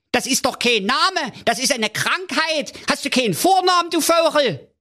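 very high, squeaky king ranting, quickening; high-pitched king scolding his servant; raging king. A narrator's reading. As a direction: splutter it like a tiny tyrant. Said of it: "tobte der König".

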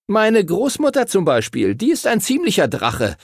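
put-on boy joking about his grandmother, calling out. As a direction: blurt it out like a cheeky boy. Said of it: "rief Timothy".

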